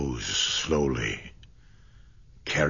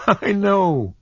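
put-on high, louder girlish laugh-line; laughing at her own mistake; 7 dB louder than usual. A narrator's reading. Kismine admits the mix-up, laughing. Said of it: "She broke into a laugh".

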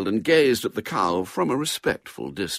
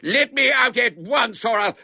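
high, loud outraged shouting; angry demand; 14 semitones higher, 7 dB louder than usual. A narrator's reading. Man locked in shed to his captor, shouting angrily. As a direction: yell to be released.

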